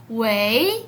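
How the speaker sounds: "wei" stretched with a rising end; muy lento y largo. Shown as a demonstration. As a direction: answer the phone with a long, rising "wei". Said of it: "muy lentamente y muy larga". («wei» largo y ascendente, contestando el teléfono)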